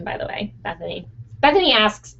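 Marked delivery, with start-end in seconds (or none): 0.0-1.1 soft aside. Real, not acted. Quiet aside to viewer.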